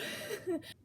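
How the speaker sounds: bright burst of laughter. Real, not acted. Gleeful laugh about love triangles.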